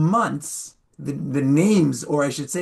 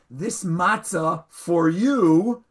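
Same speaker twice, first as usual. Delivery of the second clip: "for you" drawn out as if surplus; slow down and stretch "for you"; stress on FOR YOU; 2 semitones higher, much slower than usual. Shown as a demonstration. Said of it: "put the emphasis on the right syllable".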